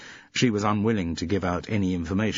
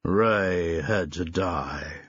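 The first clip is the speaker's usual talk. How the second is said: very slow, grave tough-guy pronouncement; slow portentous movie-hero line; much slower than usual. A narrator's reading. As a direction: announce it like doom. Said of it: "announced portentously".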